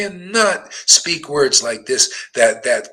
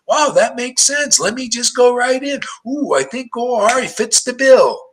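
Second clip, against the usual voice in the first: mildly wowed, higher-pitched voice; eager newcomer; 9 semitones higher than usual. Acted. Newcomer to the preacher after hearing him, eager and impressed. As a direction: play someone happily convinced on first hearing.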